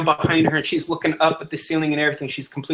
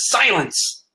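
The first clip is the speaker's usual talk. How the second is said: sharp, higher-pitched order for silence; angry command; 5 semitones higher than usual. Acted. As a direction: cut off the accusations with authority. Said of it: "smashes the fist on the table".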